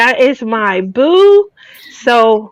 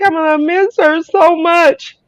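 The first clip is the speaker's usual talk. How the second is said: retold tearful line; 6 semitones higher than usual. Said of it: "I was like".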